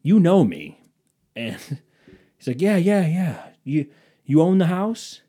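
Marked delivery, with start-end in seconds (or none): 0.0-0.8 loud, higher, annoyed reminder; acting out being mad at him; retold irritation. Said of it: "mad at him". 2.6-5.2 loud, high-pitched brush-off into pitch; put-on pushy caller voice; mock salesman. Acts out the salesman brushing past.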